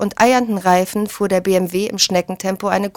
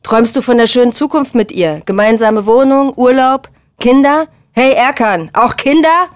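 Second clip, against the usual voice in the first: loud, high, jeering challenge; mocking, heated interrogation; taunting questions; 4 semitones higher, 5 dB louder than usual. A narrator's reading. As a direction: goad them, pushing up on each item. Taunting the friend in the quarrel.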